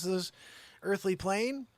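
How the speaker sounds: usual speaking voice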